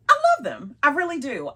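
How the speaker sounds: overselling the love; voice going up. Real, not acted. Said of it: "You hear how my voice went up?".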